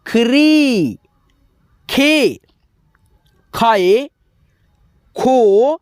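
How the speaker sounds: each form from "ख" to "खंग" held long, by itself; barakhadi drill. Shown as a demonstration. Teacher reciting for students to learn, drawn-out "ख" to "खंग". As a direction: model each syllable from "ख" to "खंग" with stretched vowels and pauses. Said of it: "अब सीखो यहाँ पे".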